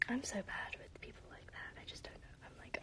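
breathy whisper, half to herself; embarrassed whispered aside. Real, not acted. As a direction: whisper it like a confession.

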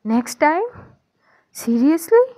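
glaring at her in disbelief; incredulous glare. A narrator's reading. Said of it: "घूरते हुए दिखते हुए बोलती".

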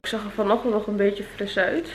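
ordinary delivery

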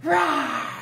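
loud playful roar; loud mad growl. Shown as a demonstration. Teacher showing children a loud mad bear growl. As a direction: show them a really mad growl. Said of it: "Make it really loud and mad".

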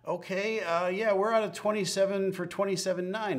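high, goofy slurred imitation; town drunk. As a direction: mimic a confused, giddy pilot. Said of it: "he's like".

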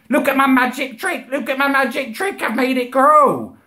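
high, excited showman call; child showing off as magician. Retold or imitated. Small child calling family in to look, showing off. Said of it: "I used to say I was a magician".

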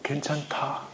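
breathy whispered "괜찮다.". Pretend. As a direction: whisper it to yourself through clenched resolve. Parent's inner resolve, whispered. (속삭이듯 "괜찮다")

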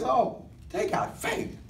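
whispered, slow and soft; soft wonder. Retold or imitated. Jesus noticing the blind men's faith, hushed. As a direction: breathe it out in quiet wonder. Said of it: "He says".

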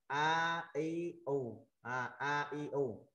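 "A I U" slowly, each vowel held; drawn-out vowel reading. Shown as a demonstration. Teacher showing how the three marks read, slow vowels. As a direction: sound out "A I U" one by one. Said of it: "mình đọc là".

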